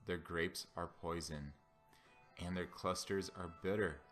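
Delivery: ordinary talk, nothing marked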